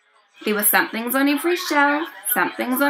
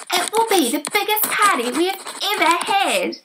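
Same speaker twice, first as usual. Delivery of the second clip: excited creatures; 7 semitones higher, faster than usual. Acted. Somethings excitedly planning party.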